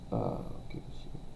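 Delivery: breathy whispered repeat. Real, not acted. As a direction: drop to a bare whisper. Same line repeated in a whisper.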